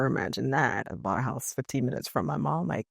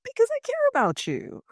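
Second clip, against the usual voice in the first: very high, cutesy protest of affection; gushing affection; 4 dB louder than usual. Acted. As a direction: coo it sweetly, way up high. Explains affectionate gift to friend.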